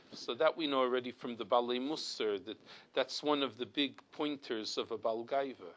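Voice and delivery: normal talking voice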